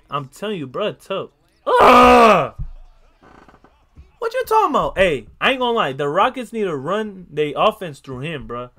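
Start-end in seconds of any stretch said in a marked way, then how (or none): 1.6-2.5 shouted anguished ugh; crying shout of frustration; loud pained groan. Real, not acted. Pained reaction to a play.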